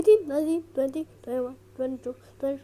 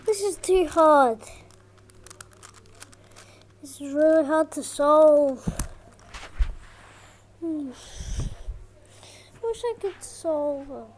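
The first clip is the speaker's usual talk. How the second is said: ordinary talk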